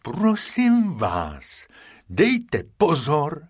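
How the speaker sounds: slow, drawn-out big beast; lumbering elephant asking gently. Acted. Bimbo the elephant warning the rabbits, slow. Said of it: "upozornil je Bimbo".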